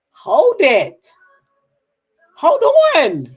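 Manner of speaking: loud, high re-enacted "whoa"; alarmed protest. Grandmother recalls balking at two boys thrust on her, alarmed protest. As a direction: call a halt, pitch jumping up loud.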